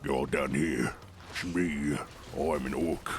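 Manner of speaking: very deep orc. Shown as a demonstration. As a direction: drop the pitch right down into an orc. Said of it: "I can't wait to do the orc voice".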